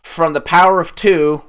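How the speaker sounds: villain proclamation. Retold or imitated. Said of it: "he's like".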